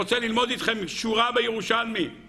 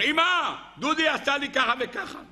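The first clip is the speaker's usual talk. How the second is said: high-pitched tattling wail to mom; mock a little brother crying out for mother; child's scream; 6 semitones higher than usual. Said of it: "ואז הוא צרח". (צרחת ילד מתלונן, בחיקוי)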